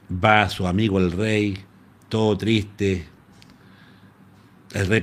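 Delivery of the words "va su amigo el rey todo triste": ordinary talk, nothing marked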